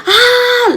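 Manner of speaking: high-pitched held "aah" scream; mock scream at normal volume; imitated yell. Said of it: "She started yelling".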